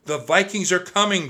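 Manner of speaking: high, loud warning shout. A narrator's reading. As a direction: raise the alarm to everyone. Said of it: "Jack shouted".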